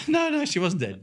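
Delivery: high, laughing denial; laughing correction. Real, not acted. Correcting the joke about the mother, laughing. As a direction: laugh it off as you set him straight.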